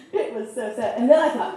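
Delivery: ordinary talk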